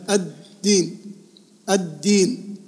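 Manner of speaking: الدين split slowly as ad-deen; doubled dal. Shown as a demonstration. Teacher showing two dals, held D in الدين. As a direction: stop on the first D of الدين, release the second. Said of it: "عبارة عن دالين اثنين".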